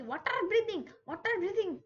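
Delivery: high, loud battle cry; calling out a move. Pretend. Gamer announces water breathing move, high loud call. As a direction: shout it like a special attack. Calls out the technique name.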